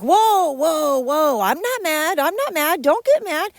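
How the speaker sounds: high, drawn-out mock alarm; mocking the partner. Imitating the partner heading off an argument, mock alarm. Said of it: "he would do this thing where he'd be like".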